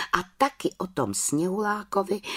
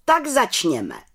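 raised head witch voice; calling out; 6 semitones higher, 3 dB louder, slower than usual. A narrator's reading. Head witch opens the council, calling out. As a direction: call it out higher, as an opening command. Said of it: "zvolala vrchní čarodějnice".

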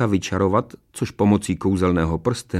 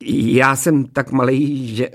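dwarf making excuses; 4 semitones higher, 3 dB louder than usual. A narrator's reading. Dwarf dodging the executioner's job, whining excuse. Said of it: "vymlouval se trpaslík Mekota".

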